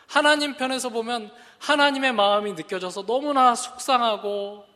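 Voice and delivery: ordinary delivery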